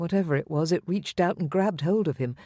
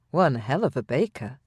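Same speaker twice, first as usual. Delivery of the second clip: plain reading voice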